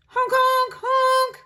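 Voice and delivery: high, loud honk honk; horn honking. A narrator's reading. Bean's bus honking at the campsite, high and loud.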